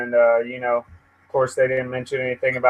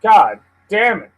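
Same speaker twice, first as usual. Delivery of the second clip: loud, higher exasperated curse; frustrated curse; 6 semitones higher, 5 dB louder than usual. Real, not acted. Streamer fed up with his lagging computer, loud curse. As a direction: let it burst out, fed up. Link loads too late.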